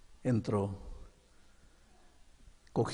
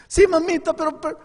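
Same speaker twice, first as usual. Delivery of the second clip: very high, loud stammering plea; desperate child; 8 dB louder than usual. Acted. Boy stammering before his mother, high and desperate.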